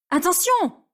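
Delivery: high sharp cry; warning cry. Acted. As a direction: shout a warning. Warning cry before crash. (cri d'alerte aigu)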